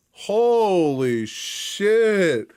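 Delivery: slow stretched-out amazement; stunned holy shit. Real, not acted. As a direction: drag it out in shock. Reacting to the price.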